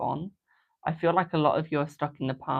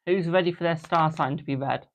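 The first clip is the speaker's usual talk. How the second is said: ordinary talk, nothing marked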